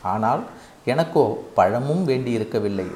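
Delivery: usual speaking voice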